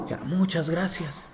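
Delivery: a hint of a dying old man; frail whisper-soft. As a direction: thank her faintly. Frail dying bishop answers.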